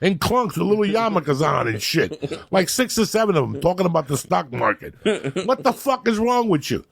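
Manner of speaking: hammed-up outrage at strangers; mock indignant yell. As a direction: rant at them in disbelief.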